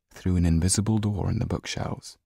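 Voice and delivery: ordinary delivery, nothing marked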